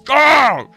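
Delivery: loud, high yell of frustration; sudden high frustrated scream; exasperated scream. Real, not acted. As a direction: let out a raw exasperated "ah!". Frustrated outburst at start.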